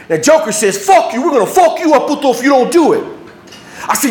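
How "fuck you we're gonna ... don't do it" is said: high, heated retold threat; angry threat. Joker threatens the kid for refusing, angry yelling. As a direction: threaten him, voice pitched up.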